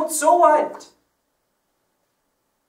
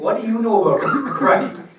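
laughing mock retort; 8 semitones higher, 10 dB louder than usual. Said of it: "you should think".

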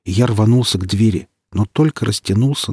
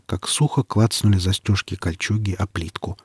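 plain reading voice

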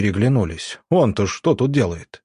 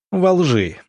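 cut off sharply; 7 semitones higher than usual. Acted. Doctor cuts off Zavyalov's question, sharp retort. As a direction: snap it short and final. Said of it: "отрезал доктор".

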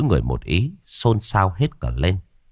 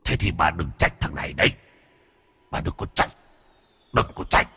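hushed, rasping whispery ghost voice; menacing ghost; 2 semitones lower, 5 dB quieter than usual. Acted. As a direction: threaten softly in a hoarse half-whisper.

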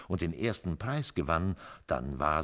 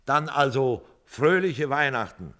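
loud cheerful toast, higher; hearty toast; 9 semitones higher, 10 dB louder than usual. A narrator's reading. Joe toasting his friends, loud and cheerful. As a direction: raise a glass and toast loudly.

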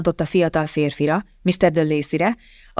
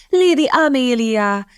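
high, drawn-out joyful call; slow, lifted cry of delight; delighted greeting; 6 semitones higher than usual. A narrator's reading. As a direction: greet with pleased surprise. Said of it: "örült meg Cecily".

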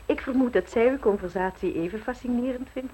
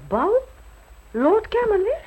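surprised; 10 semitones higher, 5 dB louder than usual. Acted. Surprised at news of a ball.

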